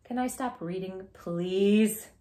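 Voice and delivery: ordinary delivery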